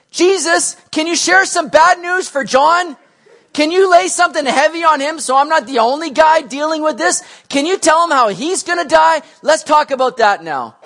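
loud, high, hammed-up grievance; pleading complaint. Peter asks Jesus to share bad news for John, loudly aggrieved.

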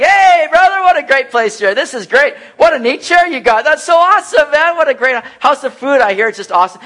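loud, high, forced cheerfulness; chirpy hospital visitor ignoring the mood; all upbeat. Retold or imitated. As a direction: visitor gushing at a sick man, hammed-up bright. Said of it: "And you're just, like, all upbeat".

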